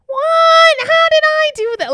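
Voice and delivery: very high, loud dumb-blonde caricature. Retold or imitated. Imitating the dumb character in Annie, high dumb blonde voice. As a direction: squeal it as a ditzy musical blonde. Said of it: "go-to voice for, like, a dumb blonde".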